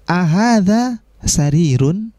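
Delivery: slow model reading. Shown as a demonstration. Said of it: "Pertanyaannya menggunakan A".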